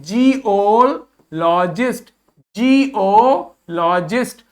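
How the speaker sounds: Geologist spelled out slowly syllable by syllable; slow teacher demo of geologist; slow model word. Shown as a demonstration. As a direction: say it slowly for the class to repeat. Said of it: "बोलो मेरे साथ".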